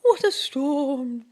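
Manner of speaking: lightly voiced chirpy bird; a small bird chattering excitedly; twittering wren. Wren twitters to the crowd in the den. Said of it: "twitters wren".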